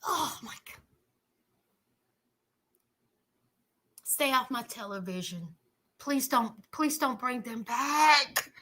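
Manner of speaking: weary mock plea; mock despair. Real, not acted. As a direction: beg quietly, like it hurts. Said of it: "pleading with TV".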